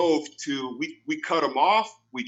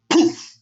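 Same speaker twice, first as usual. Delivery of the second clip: poof sound; 7 dB louder than usual. Retold or imitated. Water balloon impact sound.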